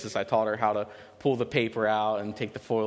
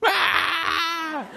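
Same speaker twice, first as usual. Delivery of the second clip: a high, loud put-on wail, all for show; fake toddler cry; 7 dB louder than usual. Daughter acting upset when caught, high loud mock wail. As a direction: squeal like a child faking tears. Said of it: "instantly she goes".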